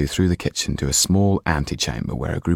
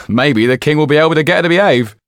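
fast, high, loud quip; a quick teasing jab; joking servant; 10 semitones higher, 7 dB louder, faster than usual. Acted. Another servant teases Dufour, fast high quip.

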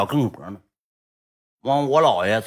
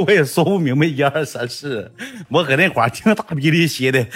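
usual speaking voice

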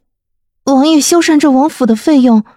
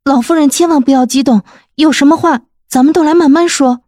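hurried; 8 semitones higher, faster than usual. A narrator's reading. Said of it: "慕容菲菲连忙说".

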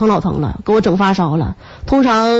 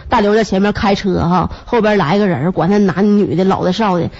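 ordinary talk

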